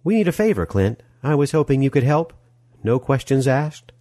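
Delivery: high, coaxing woman's voice; sweet-talking Molly. Acted. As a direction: ask a favor with a sweet smile. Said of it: "smiling so sweetly again".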